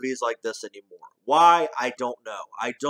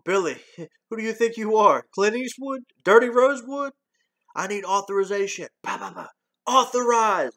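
higher, louder mock scolding; quoting Axel to Billy; 8 semitones higher, 4 dB louder than usual. Reviewer reenacting Axel teasing Billy, higher and louder. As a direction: tease him like Eddie would.

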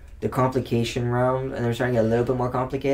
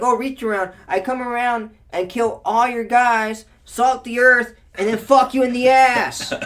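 much higher, louder, slow gloating; gloating card; 9 semitones higher, 6 dB louder, slower than usual. Retold or imitated. Mocking a card that wipes the board, high gloating.

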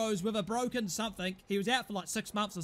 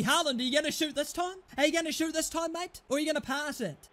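ordinary talk

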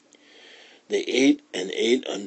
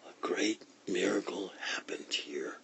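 very quiet, breath-only whisper; barely audible, secretive whisper; soft whisper; 14 dB quieter than usual. A narrator's reading. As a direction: whisper the magic words very softly. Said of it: "Sadie said softly".

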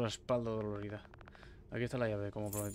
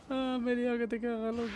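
said through laughter, much higher; high giggly admission; laughing; 12 semitones higher than usual. Real, not acted. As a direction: laugh at your own blunder as you speak. Laughing at own mess-up.

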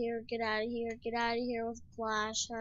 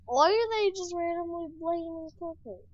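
ordinary delivery

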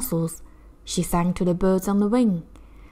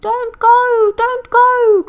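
much higher, loud seagull cries; crying seagulls; 10 dB louder, much slower than usual. Acted. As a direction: shriek the warning like gulls. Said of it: "cried the seagulls".

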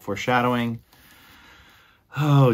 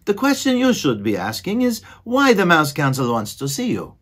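higher, louder exasperated squeaky mouse voice; exasperated mouse brother; 8 semitones higher, 4 dB louder than usual. Acted. Furlow scolding his dreamy brother, exasperated and higher. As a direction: huff it out as the fed-up brother.

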